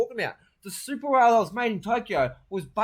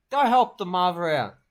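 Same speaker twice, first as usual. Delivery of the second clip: ordinary talk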